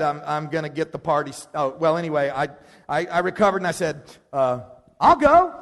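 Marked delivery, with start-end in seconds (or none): 5.0-5.5 high, loud, abrupt offer; sudden volunteering. Retold or imitated. Leader recovering from his fright to volunteer, high and loud. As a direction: pipe up loudly in a raised pitch.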